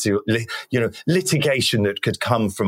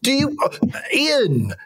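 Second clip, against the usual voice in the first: very high-pitched, hammed-up indignation; theatrically offended patriot squealing in protest; mock outrage; 14 semitones higher than usual. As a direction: act scandalised, voice shooting way up. Mock patriotic outrage at guest's criticism.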